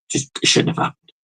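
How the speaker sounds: usual speaking voice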